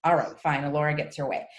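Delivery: ordinary talk, nothing marked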